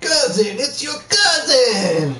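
high, drawn-out call; put-on character call. Calling to a cousin as a character, high drawn-out shout. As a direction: call it out high, stretching the last word.

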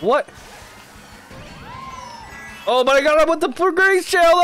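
ordinary delivery

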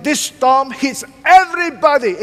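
ordinary talk

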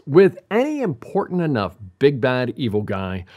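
ordinary talk

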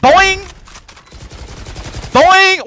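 high, loud springy "boing"; cartoon boing. Real, not acted. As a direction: call out a bouncing sound effect. Imitated bounce noise in game.

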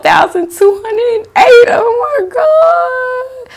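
high-pitched excited shriek; overjoyed high cry; excited squeal. Real, not acted. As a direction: squeal the number out in disbelief. Reading a milestone view count.

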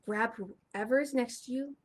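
ordinary delivery, nothing marked

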